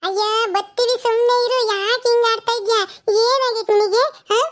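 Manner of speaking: put-on high, eager little girl asking questions; child voice.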